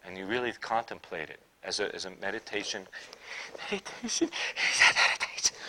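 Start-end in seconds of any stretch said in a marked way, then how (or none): 3.7-5.6 high squeak, then voiceless hiss; mock alarm. Preacher mimics people shocked by meditation, mock alarm. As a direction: put on a scandalized reaction to the word.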